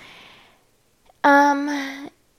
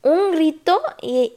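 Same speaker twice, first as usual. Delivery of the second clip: ordinary talk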